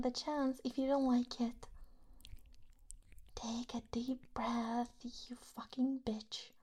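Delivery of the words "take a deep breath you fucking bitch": slow, soft, unvoiced order; whispered command. Commanding the partner in a slow whisper. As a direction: order it slowly under the breath.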